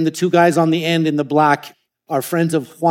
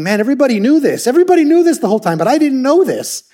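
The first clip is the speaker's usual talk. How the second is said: rising high and loud, faster and faster; amazed realization; 9 semitones higher, 5 dB louder, faster than usual. Retold or imitated. [name] reliving her first clear sight, amazed and loud. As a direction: blurt it out as the truth dawns. Recalling the moment she could see.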